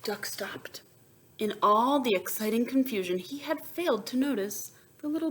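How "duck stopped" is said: soft whispered line; whispered narration. A narrator's reading. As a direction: drop to a whisper for suspense.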